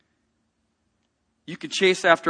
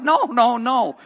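loud, higher repeated no; retold protest; 7 semitones higher, 7 dB louder, much slower than usual. Pastor pleading with the bystanders, loud urgent protest. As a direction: cut them off with urgent nos.

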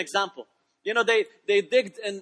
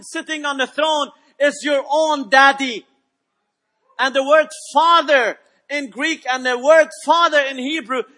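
raised, forceful sermon voice; preaching; 5 semitones higher, 7 dB louder than usual. Real, not acted. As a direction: preach it high and punchy, driving each key word home.

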